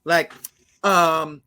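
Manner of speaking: slow, drawn-out California-girl imitation; mock California girl accent. Host mimicking a Latin girl guest, mock California accent. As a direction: drag it out in a vacant California accent. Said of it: "sworn she was from California".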